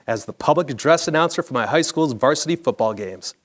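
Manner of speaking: plain reading voice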